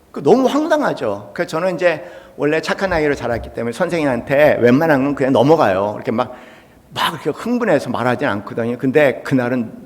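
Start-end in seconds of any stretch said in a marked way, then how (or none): none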